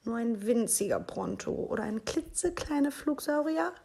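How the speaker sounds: high little wheedling voice; begging child. A narrator's reading. Little Minus bargains with Mama for a tiny pet, begging. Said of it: "bettelte Minus".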